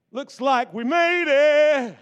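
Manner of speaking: a big slow triumphant sigh of relief; relieved triumph. Acted. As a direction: let it out like you finally survived. Bottle voiced after its long process.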